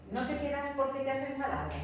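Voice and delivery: the whole question "¿No te quedas porque te has enfadado?" said fast in one breath; teacher repeating the sentence at natural speed; quick run-through. Shown as a demonstration. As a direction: rattle it off smoothly. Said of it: "Imaginaos una secuencia como esta".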